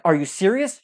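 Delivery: imitating his own incredulous outburst; storyteller scolding his coworker, loud and incredulous; exasperated.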